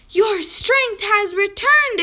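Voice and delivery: loud, high, slow exclamation; exclaimed. A narrator's reading. Mouse delighted bear sits up, high exclamation. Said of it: "exclaimed Mouse".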